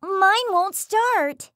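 high, drawn-out alarmed blurt. Acted. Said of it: "Hades blurted out".

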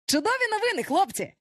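high, gleeful announcement; excited exclamation. A narrator's reading. Peter brings the boys good news, excited cry. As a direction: burst out with it high in pitch. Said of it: "вигукнув він".

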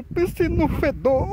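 slow, high, disgusted groan; smelling something awful. Real, not acted. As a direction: drag it out, sickened by the stench. Overwhelmed by the smell.

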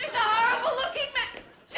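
alarmed cry; frightened shout. Acted. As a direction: scream it in fright. Wife frightened by stranger.

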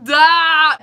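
sudden loud excited shriek; excited squeal. Real, not acted. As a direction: burst out with a delighted yelp. Excited outburst about characters.

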